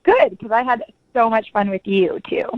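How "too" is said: ordinary delivery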